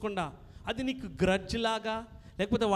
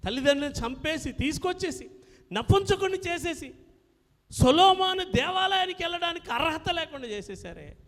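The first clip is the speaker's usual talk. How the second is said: rising from firm to full-force preaching, higher and louder; building preaching; 7 semitones higher, 6 dB louder than usual. Acted. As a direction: preach it, building to a loud peak. Preacher builds to a loud climax. (ఉద్రేకంగా పెరుగుతున్న బోధ)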